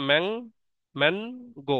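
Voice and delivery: Mang, man, গো each alone, with pauses between; separate syllables. Shown as a demonstration. Teacher pointing out two syllables, saying Mang and গো apart. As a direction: isolate Mang and গো as separate beats. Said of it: "এখানে দুইটা syllable".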